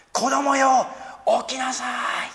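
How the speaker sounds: put-on a high, loud call to wake the dead child; Jesus's loud call. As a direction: call the girl up from death, high and strong. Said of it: "叫んで言われました".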